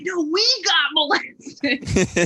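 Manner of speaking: high, slow, hammed-up whine; mock whiny protest. Comic to imagined critics, high slow mock whine. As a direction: drag it out like a joking plea.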